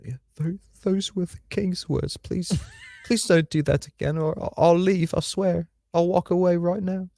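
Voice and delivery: high, slow whiny plea; begging in a squeaky put-on voice; mock pleading voice. Reading donor message in a voice.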